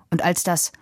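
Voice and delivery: plain reading voice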